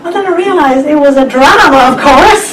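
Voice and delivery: talking through real laughter; amused laughing. Real, not acted. Speaker tells audience it was only a drama, laughing. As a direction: laugh while admitting the mistake. Laughs at her own mistake.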